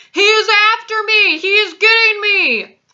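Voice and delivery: loud, high-pitched panic; panicked scream. A narrator's reading. Giant thrashing in a nightmare, panicked scream. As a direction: scream as if being chased. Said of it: "screamed the flesh lump eater".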